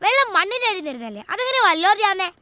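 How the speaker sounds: high, fast, loud protest; loud retort. Acted. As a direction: argue back so neighbours could hear. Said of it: "Achu, പതുക്കെ പറ". (ഉറക്കെയുള്ള തർക്കുത്തരം)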